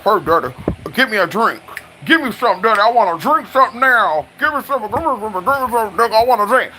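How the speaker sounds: put-on wheedling, words running into each other; playing a role. Flirt begging daddy for a drink, hammed-up playful role. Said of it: "I'll play that role for real".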